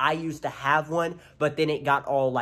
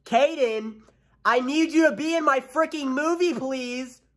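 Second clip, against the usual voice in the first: much higher, louder, pushy plea to a friend; aggressive pleading; 12 semitones higher, 5 dB louder than usual. Real, not acted. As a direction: demand he join the movie, loud and high. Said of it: "That sounded very aggressive".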